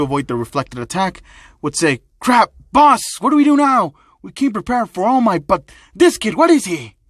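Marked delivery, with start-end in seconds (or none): 2.2-7.0 loud, high-pitched acted panic; panicked alarm. Thug asks his boss what to do, panicked.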